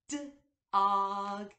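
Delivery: "D-og" split into D and og, loud; sounded out. Shown as a demonstration. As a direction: stretch "D-og" apart sound by sound. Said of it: "break down the beginning, middle, and ending sound".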